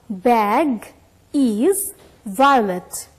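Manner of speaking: very slow, word by word; slow model reading. Shown as a demonstration. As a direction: stretch it out for the class to copy.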